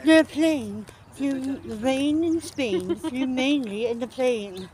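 slow, careful mock British elocution; put-on British accent, stretched vowels; old-fashioned British accent. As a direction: recite it slowly in outdated British speech. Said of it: "We don't talk like that as Brits".

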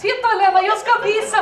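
Russian neighbour confiding. Retold or imitated. Svetlana telling Elena about her groin pain, high and chatty. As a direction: overshare cheerfully about her aches. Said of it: "Hon bara".

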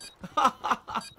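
short exasperated laugh; incredulous laugh. Real, not acted. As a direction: laugh in disbelief at the unfairness. Laughs at absurd death.

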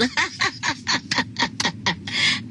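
long, high run of laughter; real laughter. Real, not acted. Laughing at a viewer's comment.

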